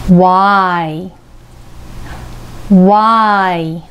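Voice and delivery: Y said as わ plus the vowel I; Yをはっきり発音; English letter Y. Shown as a demonstration. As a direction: say Y with rounded lips opening onto I. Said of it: "母音からできています".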